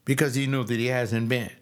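ordinary talk

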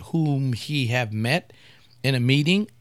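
usual speaking voice

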